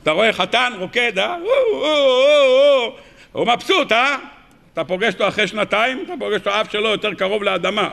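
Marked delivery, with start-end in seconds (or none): 1.4-2.9 mock joyful whoops of a dancing groom; imitating a groom dancing with glee; joyful dance cries. Groom dancing at his wedding, high joyful whoops.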